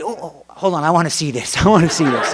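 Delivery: playfully egging him on; eager excitement. Retold or imitated. Wife eager to watch the pancake stunt, excited.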